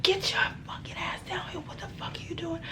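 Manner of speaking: urgent whisper-yell, high and hushed. Retold or imitated. Telling cousin to get down without alerting the shooter, whispered yell. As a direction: yell at her under your breath. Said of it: "I'm trying to be quiet at the same time".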